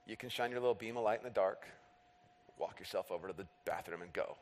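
very soft, low inner voice; thinking to oneself. Retold or imitated. Dad's private thought about his daughter's bathroom trip, low and hushed. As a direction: grumble privately under your breath, dropping away. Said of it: "I think to myself".

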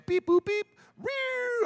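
high-pitched phone beeps, then a siren whoop; imitated dialling and siren. Storyteller shows how fast police come, high imitated beeps and siren. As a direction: mimic phone tones and a squad car.

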